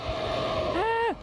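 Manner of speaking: high startled shriek; startled scream. Real, not acted. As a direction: shriek in sudden fright. Scared by monster.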